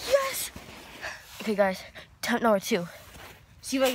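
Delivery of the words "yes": excited cheer. Real, not acted. Lands first snowboard run.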